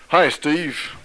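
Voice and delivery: put-on a keen American-accented greeting; enthusiastic American. Caller Ronnie greets Steve, eager American accent. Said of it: "this voice came enthusiastically across the phone".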